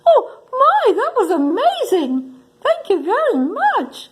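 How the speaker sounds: high little mouse voice; squeaky mouse. Acted. Mouse thanks the lion, high and squeaky. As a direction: play the tiny grateful mouse, high.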